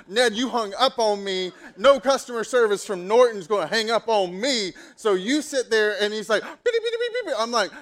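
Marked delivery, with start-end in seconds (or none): 0.1-6.0 loud, high-pitched telling-off; retold indignant tirade; fired-up scolding. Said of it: "I was so fired up". 6.4-7.3 very high, loud beeps; rapid squeaky mock bleeping; bleeped-out cursing. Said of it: "cussing at me".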